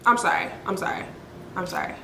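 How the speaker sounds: low, hushed apology, fading; sinking into a soft, low sorry. Real, not acted. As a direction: drop down and apologise quietly. Apologises aside to listeners.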